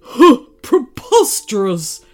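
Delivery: loud, slow, outraged tiger roar. A narrator's reading. Tiger denying being in love, roared indignantly. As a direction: roar it with a scoffing huff. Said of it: "roared the tiger".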